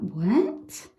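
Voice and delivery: mock surprise at an ex turning up at the door; mock shocked surprise. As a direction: act caught off guard by the visitor. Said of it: "this is you opening the door like that".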